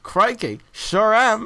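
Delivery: loud, hammed-up Aussie accent; exaggerated fake-Australian catchphrase; worst Australian accent. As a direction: belt it out in a terrible Australian accent. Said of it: "puts on the worst Australian accent ever".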